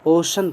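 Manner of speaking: ओशन read with palatal श, o-shan. Shown as a demonstration. Teacher reminds students of the palatal sh. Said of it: "तालव्य श".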